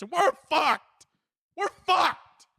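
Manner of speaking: ranting shout that we're doomed; exasperated shout. Real, not acted. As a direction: yell it like the world is ending. Ranting about world burning.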